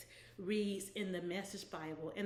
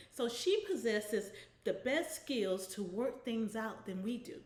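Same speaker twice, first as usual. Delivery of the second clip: ordinary delivery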